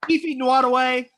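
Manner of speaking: big curtain-call introduction; announcer roll call. Real, not acted. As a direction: present the performer to applause. Host's roll call of the cast.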